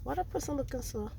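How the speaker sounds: dropped to a near whisper about a stranger; hushed. Real, not acted. Asks husband about a stranger outside, hushed. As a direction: keep it hushed so they won't hear.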